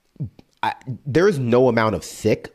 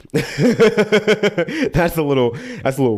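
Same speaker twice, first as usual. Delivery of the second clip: high, slow words broken by a laugh; laughing through the words; 8 semitones higher, slower than usual. Real, not acted. Laughing at the rude line while reading it.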